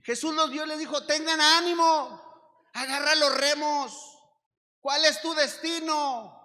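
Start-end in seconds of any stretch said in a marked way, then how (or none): 1.1-6.4 loud, high preacher's charge; rousing exhortation. Acted. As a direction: drive the rowers on with full voice. Preached command to keep rowing.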